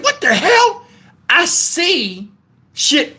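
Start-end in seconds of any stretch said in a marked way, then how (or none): 0.0-0.8 high, startled exclamation; mock startled reaction. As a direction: react in disbelief, pitched way up.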